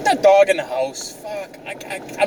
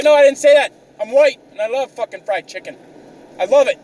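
loud, high-pitched denial; indignant protest; 7 semitones higher, 4 dB louder than usual. Retold or imitated. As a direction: act out defending yourself hotly. Retold protest against accusation.

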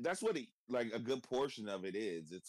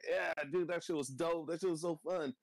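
higher, overdone agreeing buddy; mock enthusiasm; 8 semitones higher than usual. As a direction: play along with him, hammed-up. Humoring friend's wrestling nostalgia.

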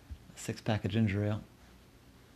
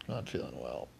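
sick voice; 10 semitones lower, 7 dB quieter than usual. Acted. Sick friend answering the door.

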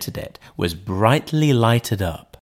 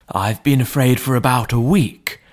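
put-on a frightened old butler; anxious butler; 2 semitones higher than usual. A narrator's reading. Butler Poole in story.

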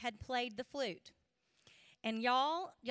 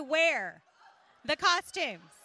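louder, punched dismay at the costumes; exaggerated dismay; 6 dB louder, slower than usual. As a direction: sell the horror of it. Dismay at wearing green spandex.

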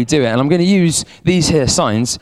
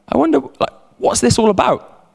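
higher-pitched imitation of puzzled people; puzzled wondering; 7 semitones higher than usual. Preacher voices people left questioning during pandemic, puzzled wondering. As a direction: voice others wondering aloud, pitched up.

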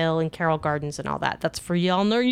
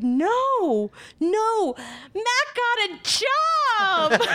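very high, drawn-out squeal; exasperated joy; 13 semitones higher, much slower than usual. Retold or imitated. Wife correcting her friend's mishearing, squealing. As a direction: climb higher to a squealed punchline.

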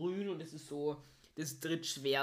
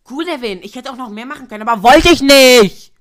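loud defiant outburst; shouting; 7 semitones higher, 26 dB louder than usual. Real, not acted. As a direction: yell it at your friend, fired up. Bragging outburst at friend.

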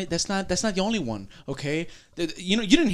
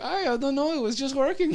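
high-pitched mock Romanian accent; imitated Romanian woman's accent; 9 semitones higher than usual. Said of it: "And she's like".

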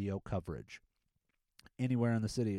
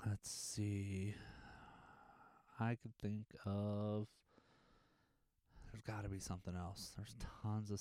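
muttered to himself; 9 dB quieter than usual. Real, not acted. Thinking aloud to himself.

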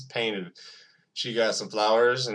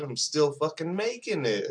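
ordinary delivery

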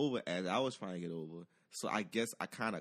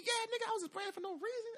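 defensive. Retold or imitated. Defensive retort to passenger.

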